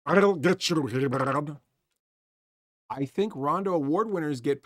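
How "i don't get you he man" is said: mock sea creature talking from underwater; merman voice. Shown as a demonstration. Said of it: "is merman sound like that".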